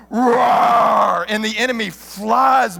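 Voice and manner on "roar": big, loud lion roar; father lion's roar. Acted. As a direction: let out a mighty imitated roar, loud and full. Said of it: "there's this other roar that's like".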